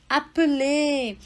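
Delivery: Appeler slowly, aple, closed final E. Shown as a demonstration. Teacher to student: slow French appeler. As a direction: stretch out Appeler to show the closed E. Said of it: "é diferente do appel".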